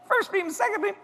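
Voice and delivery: high giggly. Retold or imitated. Laughing high retelling.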